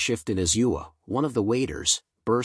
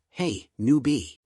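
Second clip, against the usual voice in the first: slower, drawn-out call; 3 semitones higher, slower than usual. A narrator's reading. Scouting member suddenly hailing the newbie, calling out. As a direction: call out to a newcomer. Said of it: "suddenly called out".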